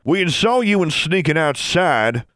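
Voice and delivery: high, slow accusing call; said loudly. A narrator's reading. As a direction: call it out loudly across the field. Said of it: "Benjamin Rash said loudly".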